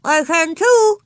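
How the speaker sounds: put-on high, loud defiant child; little girl, mouth full. A narrator's reading. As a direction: talk back through a mouthful, high and loud. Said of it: "said Ramona through her mouthful".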